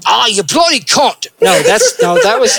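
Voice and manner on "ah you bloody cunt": high-pitched put-on Aussie accent; Australian swearing. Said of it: "just swear and it'll sound instantly Australian".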